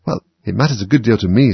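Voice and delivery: usual speaking voice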